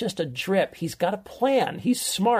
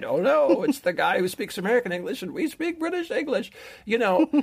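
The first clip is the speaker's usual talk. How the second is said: mock sarcastic critic; 5 semitones higher than usual. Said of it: "They just thought, like".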